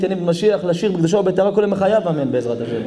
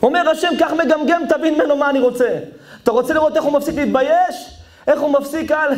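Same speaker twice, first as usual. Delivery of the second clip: high, urgent exhortation; full-force preaching; 9 semitones higher than usual. Real, not acted. Preacher relaying God's challenge about the stutterer, full-force preaching. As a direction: press it on the crowd, pitched high.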